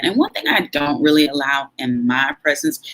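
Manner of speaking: ordinary delivery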